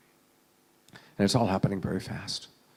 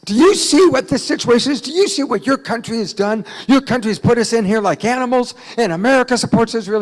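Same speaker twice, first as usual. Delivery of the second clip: loud, high, angry shouting tirade; worked-up heckler; 13 semitones higher, 14 dB louder than usual. Retold or imitated. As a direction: yell accusations at the speaker on stage. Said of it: "the guy is pretty worked up".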